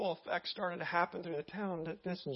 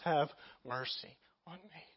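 broken plea; 4 semitones lower, 7 dB quieter than usual. Acted. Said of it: "he was a broken man".

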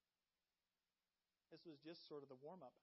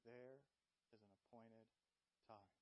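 tender reassurance; 7 semitones lower, 8 dB quieter than usual. Real, not acted.

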